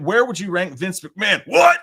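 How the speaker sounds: loud, high wrestler-style hollering; Stone Cold. Acted. Streamer reading a wrestling question in his imperfect impression, loud hollering. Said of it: "I have your Stone Cold".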